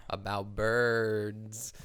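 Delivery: very slow, droning lecturer. Retold or imitated. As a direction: drag it out like a boring speaker. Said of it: "this guy talked like this".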